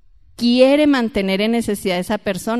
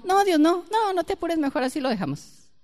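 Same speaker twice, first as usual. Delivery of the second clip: mock scared plea; 7 semitones higher than usual. Imagines fearfully refusing God's offer.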